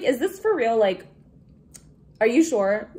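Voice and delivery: loud, stunned disbelief; disbelieving. Retold or imitated. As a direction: ask it as if you can't believe it. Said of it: "I was like".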